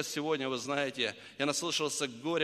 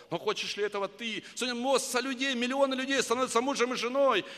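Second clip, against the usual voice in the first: preaching; 9 semitones higher, 4 dB louder than usual. Acted. Preaching at full force.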